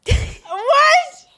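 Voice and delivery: very high, loud shriek of disbelief; piercing, scandalized outburst; shocked shriek. Real, not acted. Shocked at the story's crude detail.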